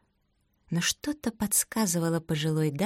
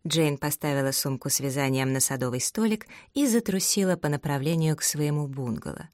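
ordinary delivery, nothing marked

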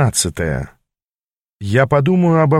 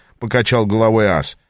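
ordinary delivery

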